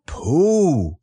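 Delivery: drawn-out solemn address. A narrator's reading. Christopher Robin before his announcement, solemn. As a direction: say the name slowly and gravely. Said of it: "said Christopher Robin solemnly".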